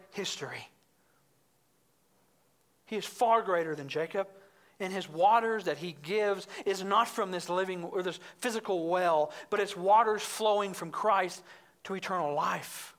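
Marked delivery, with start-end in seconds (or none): none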